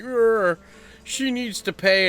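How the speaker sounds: high, drawn-out mock grumble; mocking incel impression. Complainers about it demanding payment, mocking whiny incel voice. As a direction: whine it out slowly as a caricature. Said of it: "little fucking fat guys with beards being like".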